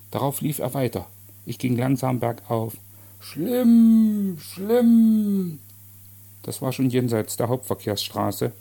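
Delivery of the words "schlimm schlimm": loud, high, long falling cries; distant wailing call. Acted. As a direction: call it mournfully from far down the street. Distant cry of departing man. (langgezogener, klagender Ruf)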